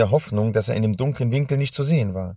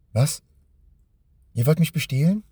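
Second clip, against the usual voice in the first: higher-pitched startled question; mildly shocked boy, rising in disbelief; taken aback; 6 semitones higher than usual. A narrator's reading. Boy realises he is being robbed.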